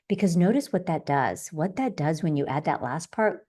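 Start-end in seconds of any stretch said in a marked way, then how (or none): none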